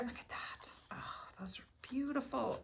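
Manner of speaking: soft unvoiced whisper of wonder; awed whisper. Real, not acted. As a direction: breathe it out in hushed delight. Hushed awe at die cuts.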